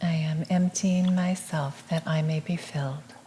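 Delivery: soft, measured meditative repeat; hushed affirmation, evenly spoken; affirmation echo. Real, not acted. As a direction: repeat it gently as a quiet response. Echoes the affirmation.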